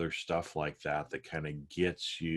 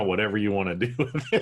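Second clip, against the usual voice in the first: fast, still raised cartoonish voice; trailing funny voice; 4 semitones higher, 6 dB louder, faster than usual. Shown as a demonstration. Still in the silly voice, fast and raised. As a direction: let the squeaky voice fade out quickly. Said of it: "giving it a funny voice".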